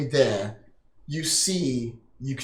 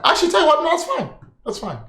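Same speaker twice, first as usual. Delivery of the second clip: ordinary talk, nothing marked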